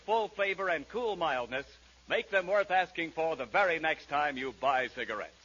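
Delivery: ordinary talk, nothing marked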